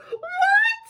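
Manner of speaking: very high, squeaky cry of surprise; queen squeaking in shock. Acted. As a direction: squeal it in disbelief.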